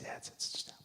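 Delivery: unvoiced, hushed aside; whisper. [name] whispering to the old woman on stage, hushed. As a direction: keep it secret on stage.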